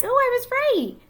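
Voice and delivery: high, bright-eyed little cheer to the class; delighted. Real, not acted. Prediction proved right.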